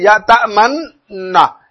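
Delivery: "तमन्ना" drawn out very slowly with rounded lips (ishmam); rounded-lip demo. Shown as a demonstration. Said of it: "ऐसे होंठ को यूं गोल करते हैं".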